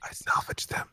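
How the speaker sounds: hushed whisper. Real, not acted. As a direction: whisper it secretly. Whispered then repeated aloud.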